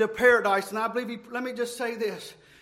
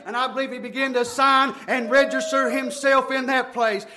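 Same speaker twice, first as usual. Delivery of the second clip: driving, rhythmic revival sermon; full-force preaching; 2 semitones higher, 4 dB louder than usual. Real, not acted. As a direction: preach it hard without letting up.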